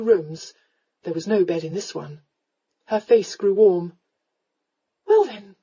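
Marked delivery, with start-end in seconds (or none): 5.1-5.5 higher, measured goodnight; polite. A narrator's reading. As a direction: take leave with careful politeness. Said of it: "she said politely".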